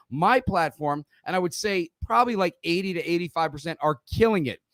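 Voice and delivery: ordinary talk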